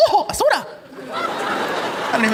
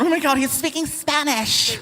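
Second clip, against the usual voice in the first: mock freaked-out onlooker; 5 semitones higher than usual. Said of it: "it freaks people out".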